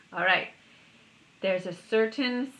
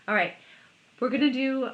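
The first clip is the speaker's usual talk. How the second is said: ordinary talk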